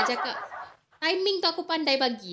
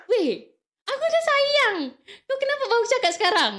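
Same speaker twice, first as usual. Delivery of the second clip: upset retold protest; 10 semitones higher than usual. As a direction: replay her own dismayed complaint. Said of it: "Aku cakap".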